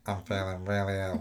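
lightly imitating a nasal ill voice; a hint of a sick whine through the nose. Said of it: "Like on Monica on Friends".

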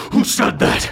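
a hint of a hissed high dragon challenge; dragon's demand. Dragon Wilhelm turns toward the intruder and demands. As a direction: hiss out a sharp question. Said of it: "turns up and goes".

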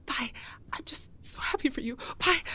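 whispering while tiptoeing out; sneaking in late. Acted.

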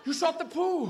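high dramatic outcry; mock-horrified dog. Dog reacting to owner's shot, high mock horror. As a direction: cry it out high in mock shock.

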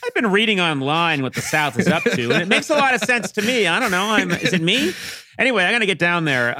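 sheepish half-laughing confession; a hint of a gullible reader; mock convert. Playing a conspiracy convert.

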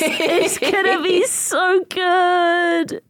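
high, slow gushing excitement; excited squeal. Real, not acted. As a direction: drag it out, thrilled.

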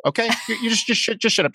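ordinary talk, nothing marked

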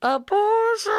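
over a radio. Pretend. Joining the radio call.

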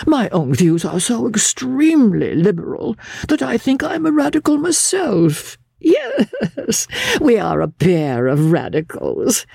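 high, fretful gentleman coaxing; an effete aesthete wheedling his guest; fussy soothing. Acted. Fussy host coaxing angry Gilmore toward the etchings, high wheedling voice.

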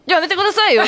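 laughing protest. Real, not acted. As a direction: laugh while you protest.

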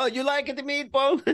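put-on very high, playful Italian accent. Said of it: "Oh, now I'm gonna do Italian".

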